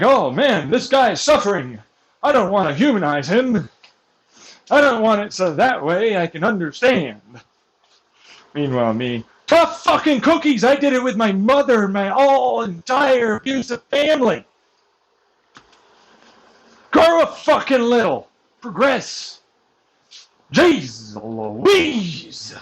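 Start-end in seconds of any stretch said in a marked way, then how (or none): 0.0-7.2 mocking quote. 9.5-22.5 furious, higher and louder rant; angry shout. Real, not acted. Ranting about abusers.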